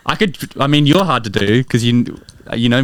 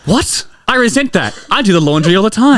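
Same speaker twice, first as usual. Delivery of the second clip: gasp, then high offended protest; hammed-up high-pitched outrage; acted indignation; 8 semitones higher than usual. Jim to friends who accuse him of laziness, mock-offended.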